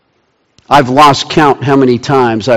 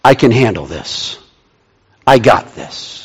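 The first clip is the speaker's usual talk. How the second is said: lower, softer, unhurried answer; calm assurance; 4 semitones lower, 4 dB quieter than usual. Acted. God answering Habakkuk's outcry, calm assurance. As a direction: settle it quietly, with a pause between. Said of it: "And God's response is".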